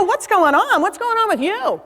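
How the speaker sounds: high-pitched, incredulous retold question; acted disbelief, swooping high; astonished. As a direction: play the baffled daughter, pitch jumping up. Acted astonished daughter on phone.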